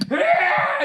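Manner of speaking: sharp high yelp of pain; taser scream. Retold or imitated. Mock taser victim yelping in pain. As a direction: yelp like you just got tased. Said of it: "you hear".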